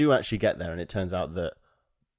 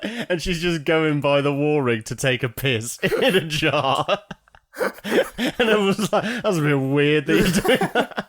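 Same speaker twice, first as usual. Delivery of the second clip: real laughter breaking through, high and loud; cracking up; 7 semitones higher, 5 dB louder than usual. Real, not acted. Friend telling an absurd film scene, cracking up. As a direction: struggle to talk while laughing.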